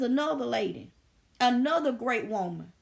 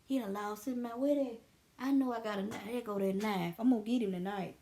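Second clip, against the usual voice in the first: muttered plotting; 5 semitones lower, 6 dB quieter than usual. Retold or imitated. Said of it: "I'm like".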